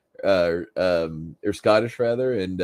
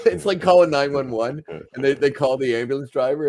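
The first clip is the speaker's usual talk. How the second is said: normal talking voice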